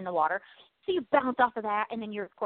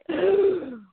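mock worried gasp; 6 semitones higher, 4 dB louder than usual. Imagining disaster.

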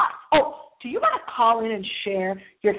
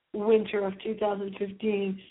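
usual speaking voice

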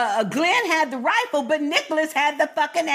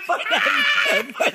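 laughing; 11 semitones higher, slower than usual. Real, not acted. Laughs thanking Tammy's voicemail.

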